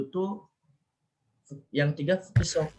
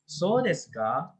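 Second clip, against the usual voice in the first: drawn-out "So desu ka" with "ka" going up; rising question intonation; 3 semitones higher, much slower than usual. Shown as a demonstration. Teacher repeating the questioning version for students, "So desu ka" rising. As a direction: lift the end of "So desu ka" as a question. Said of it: "itu nanya kan kalau dia naik".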